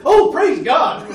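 high, loud, drawn-out rejoicing; excited praise. Retold or imitated. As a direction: imitate an overjoyed churchgoer praising. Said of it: "he was real excited".

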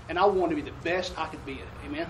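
ordinary talk

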